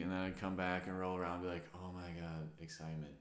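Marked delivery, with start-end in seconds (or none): 1.7-3.1 retold awe, low and soft; soft, lower-pitched acted wonder; hushed realization. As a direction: let the tension go, quietly reliving the realization. Reenacts relaxed realization after clenching.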